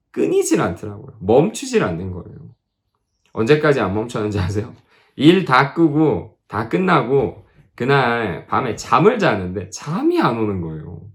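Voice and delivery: ordinary delivery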